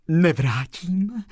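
high, drawn-out joyful refusal; breathed out. A narrator's reading. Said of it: "vydechla slečna Marie". (radostně, protáhle vydechnout)

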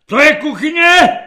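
high, loud bellowed question; parade-ground voice. A narrator's reading. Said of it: "otázal se hlasem, který by dobře uplatnil na vojenské přehlídce". (hlasem jako na vojenské přehlídce)

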